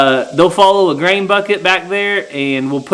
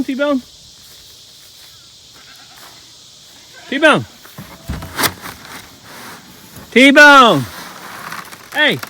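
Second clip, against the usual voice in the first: calling out; 8 semitones higher, 4 dB quieter, slower than usual. Real, not acted. Calling steers across the pasture.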